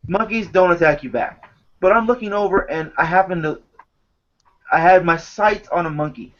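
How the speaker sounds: ordinary talk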